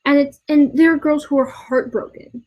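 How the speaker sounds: ordinary delivery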